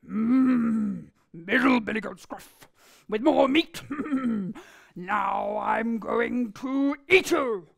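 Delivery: loud, slow greedy troll growl; troll savouring a meatier goat, menacing. Acted. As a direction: relish the threat like a hungry troll. Troll threatens to eat goat.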